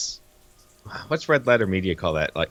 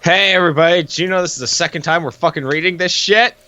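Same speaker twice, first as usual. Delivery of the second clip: exasperated call-out; 6 semitones higher, faster than usual. Real, not acted. Complaining to audience about re-recording.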